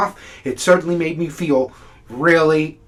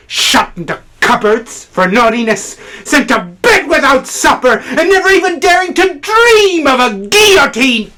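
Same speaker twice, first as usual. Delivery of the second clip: climbing to a high, ringing peak; mounting outrage; 10 semitones higher, 6 dB louder than usual. Acted. Critic railing at being treated like children, mounting outrage. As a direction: build steadily into an outcry. Tirade climaxes on punishments.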